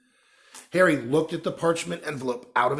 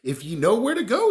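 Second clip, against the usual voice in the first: Hagrid, coaxing; 9 semitones higher, 7 dB louder than usual. A narrator's reading. Hagrid voiced higher, louder.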